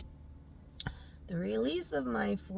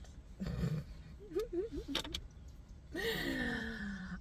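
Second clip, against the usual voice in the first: soft, high, drawn-out giggle; 7 semitones higher, 9 dB quieter than usual. Real, not acted. Vlogger declaring herself her brand, soft high giggling. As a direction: giggle quietly, trailing into a long breath.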